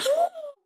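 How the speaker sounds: sharp high gasp of mock shock; a hammed-up scandalised gasp; mock horrified gasp. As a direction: gasp as if appalled. Wife gasping at flushing idea.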